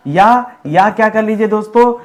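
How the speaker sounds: normal talking voice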